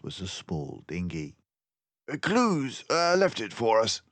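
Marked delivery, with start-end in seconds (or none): 2.1-4.0 high, loud, brisk detective voice; a clever mouse sleuth, pleased with himself; Basil the mouse detective. A narrator's reading. Narrator voicing Basil to Dawson, high and loud. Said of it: "said Basil".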